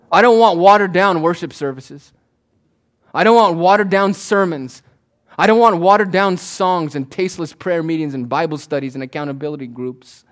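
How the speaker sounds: loud, driving sermon build; full-force preaching. Acted. Pastor urging the congregation to grow saltier, full-force preaching. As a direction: preach it hard to the pews. Pastor declares what he refuses.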